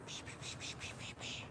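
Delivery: soft unvoiced psh-psh to lure a cat; cat-calling psh. Real, not acted. Luring his cat Lucky, soft psh-psh sounds. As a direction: hiss psh psh to call the cat.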